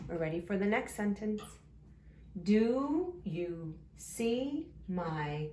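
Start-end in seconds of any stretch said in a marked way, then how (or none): 2.4-5.5 very slow, each word stretched; drawn-out early-reader pacing; word-by-word reading. Shown as a demonstration. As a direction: read along slowly, one word at a time. Sounding out a sentence word by word.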